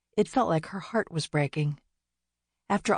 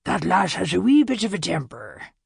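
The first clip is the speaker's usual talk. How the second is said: louder, rolling Scottish brogue; thick Scottish brogue; 2 semitones higher, 5 dB louder than usual. A narrator's reading. Scottish statue commenting on Winnie, thick Scottish brogue. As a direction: give it a broad Scottish lilt. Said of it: "said in his thick Scottish brogue".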